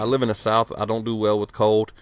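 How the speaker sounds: ordinary talk, nothing marked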